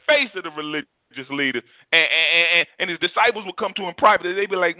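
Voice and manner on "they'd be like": usual speaking voice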